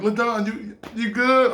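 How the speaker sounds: put-on high, mildly girlish question; girly girl. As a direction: check on him in a girly-girl lilt. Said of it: "she had this girly girl".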